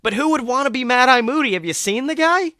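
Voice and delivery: high, loud disbelief; can't believe anyone would want that; a student baffled by his teacher's claim, high and incredulous. Acted. Samuel incredulous about Moody.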